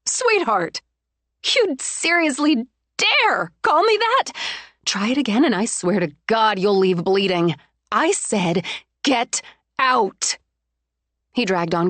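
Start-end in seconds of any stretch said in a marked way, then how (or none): 0.1-10.4 icy threat. Acted. Bride threatening fiancé, seething. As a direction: dare him to say it again.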